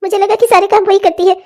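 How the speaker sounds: a mother begging forgiveness of her daughter-in-law; remorseful old mother. Acted. Voices old mother role. (पछताती हुई बूढ़ी माँ)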